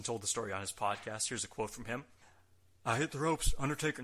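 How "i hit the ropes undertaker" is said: lightly attempted wrestler voice, louder and higher; loud, raised hint of a wrestler's voice; Steve Austin impression. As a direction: start a half-hearted wrestler impression. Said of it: "I won't do it in Austin's voice".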